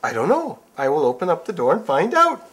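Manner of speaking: high, loud, eager; excited prince. Acted. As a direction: answer full of excitement.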